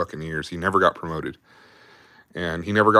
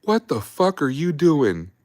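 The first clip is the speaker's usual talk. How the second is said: mock sergeant yell; 10 semitones higher, slower than usual. Sergeant finds a private huffing exhaust, high slow angry yell. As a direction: bellow his name, then drag the question out slowly. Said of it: "our sergeant goes".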